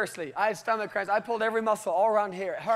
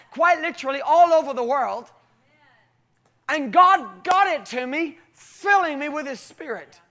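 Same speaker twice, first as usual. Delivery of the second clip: loud, raised, driving testimony; impassioned preaching; 4 semitones higher, 5 dB louder than usual. Real, not acted. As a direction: preach it at full force, punching key words. Testimony builds to preaching climax.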